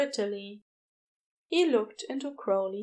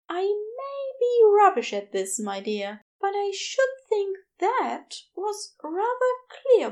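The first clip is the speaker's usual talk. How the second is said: higher, gentle fussy Aziraphale voice, embarrassed; flustered angel; 10 semitones higher, 4 dB louder than usual. A narrator's reading. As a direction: play a flustered, apologetic angel.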